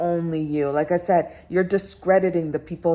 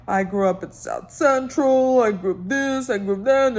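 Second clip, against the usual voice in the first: mock sob story voice; 3 semitones higher than usual. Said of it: "she's full of sob stories".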